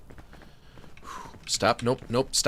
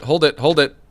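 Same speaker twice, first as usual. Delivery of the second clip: ordinary delivery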